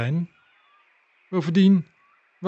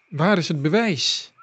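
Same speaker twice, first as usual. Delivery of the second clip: plain reading voice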